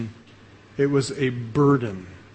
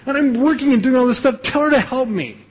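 Martha storming; 9 semitones higher than usual. Retold or imitated. Martha storms out to Jesus complaining about Mary, high indignant whine. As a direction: whine it up high like an outraged sister. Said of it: "She storms out there and says".

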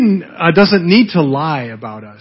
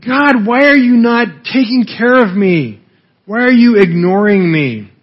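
angry complaint; 5 semitones higher than usual. Retold or imitated. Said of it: "And it makes you angry".

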